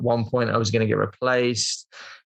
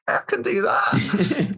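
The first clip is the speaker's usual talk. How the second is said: high, slow, squeaked claim; mock-eager bluff; 9 semitones higher, much slower than usual. Actor pretending to be a gymnast, mock-eager. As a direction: stretch it out high, sending up your own bluff.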